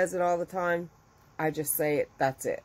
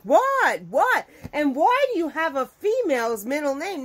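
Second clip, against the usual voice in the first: loud, high-pitched baffled questions; acted disbelief; 9 semitones higher, 5 dB louder than usual. Wife stunned by husband's middle name, loudly incredulous. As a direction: blurt it out high, demanding an explanation.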